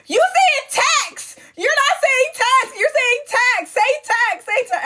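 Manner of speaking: very high, drawn-out mocking chorus; couch hecklers. Housemates on the couch correcting the host, exasperated. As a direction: imitate exasperated onlookers in a high squeal. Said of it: "everybody on the couch like".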